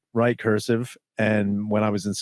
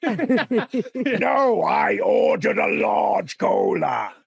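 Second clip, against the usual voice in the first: high, drawn-out mock-demon shout; Pinhead calling out; 10 semitones higher, much slower than usual. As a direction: call a food order to the next room, villain style. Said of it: "He's also talking to someone in the other room".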